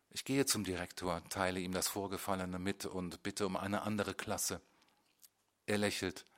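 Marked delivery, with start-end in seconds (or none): none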